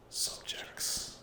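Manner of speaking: ordinary delivery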